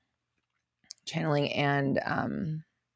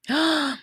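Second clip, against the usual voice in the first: gasp; 10 semitones higher than usual. Real, not acted. Reacting to viewer's pink hair.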